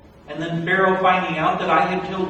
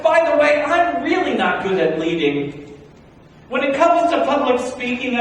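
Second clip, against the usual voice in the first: loud, higher, pleading objections; frantic protest; 7 semitones higher, 7 dB louder than usual. Acted. Moses begging the voice to pick someone else, loud and frantic. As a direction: argue your way out of it.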